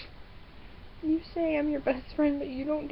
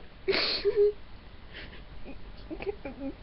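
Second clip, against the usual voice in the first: breathy, high sobbing; crying without words; real sob; 5 semitones higher, 4 dB quieter than usual. Real, not acted. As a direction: break into a long tearful sob. Sobs after wanting to cry.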